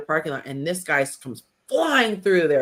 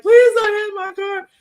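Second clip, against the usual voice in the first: high, slow, louder pleading; pleading fear; 7 dB louder than usual. Retold or imitated. Driving friend's car past road rage, high slow pleading. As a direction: beg in a high voice, drawn out.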